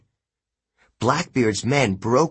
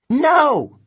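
high, loud cry of no; scream; 7 dB louder than usual. A narrator's reading. Sorceress seeing the vitamins poured into the cage, screaming. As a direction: shriek it in dismay. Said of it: "Circe screamed".

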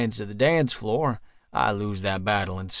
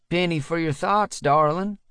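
sing-song; 5 semitones higher than usual. A narrator's reading. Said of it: "a familiar voice sing-songs".

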